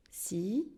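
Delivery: "Citron" with a very long gap after ci; syllable by syllable. Shown as a demonstration. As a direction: hold back "tron" in "Citron" after a silence. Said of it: "Toujours avec les mains". (« Citron » découpé, avec une longue pause)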